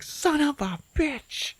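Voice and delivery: high, slow, strained mock curse; retold exasperated curse. Frank reacting to the corn's singing, exasperated.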